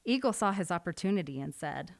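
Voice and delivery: plain reading voice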